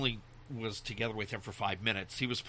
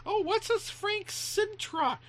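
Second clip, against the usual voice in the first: put-on very high, slow kid voice; kid's question; 4 dB louder, slower than usual. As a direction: ask like a small child, drawn out. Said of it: "And it would be like".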